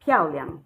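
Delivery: "piaoliang" with a falling piao and an abrupt, toneless liang; falling tone, neutral tone. Shown as a demonstration. Teacher repeating the word for students, falling then abrupt neutral tone on "piaoliang". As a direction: drop the pitch on piao in "piaoliang", cut liang short. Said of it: "this is falling tone, and here you pronounce it abruptly".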